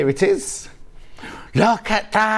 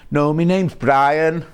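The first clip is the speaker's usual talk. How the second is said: put-on Brummie accent; 2 semitones lower, much slower than usual. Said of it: "Except in a Brummie accent".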